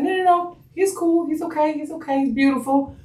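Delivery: high, soothing retold reassurance; reassuring. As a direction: soothe a worried new mom in a high voice. Medical staff reassuring the new mother.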